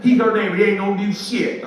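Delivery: high, loud retold outburst; agitated wife. Wife scoffing to her husband about the neighbor, agitated and loud. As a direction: imitate a wife arguing at full tilt. Wife arguing back in story.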